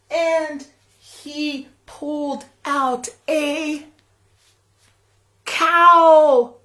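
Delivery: put-on big reveal to the kids; surprise reveal. A narrator's reading. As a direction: hold back, then burst out with cow. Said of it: "big reveal of the cow".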